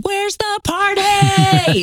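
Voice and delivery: high, drawn-out party whoop; big sustained high call to the whole room; party-crasher holler. Acted. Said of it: "walked into any room and said".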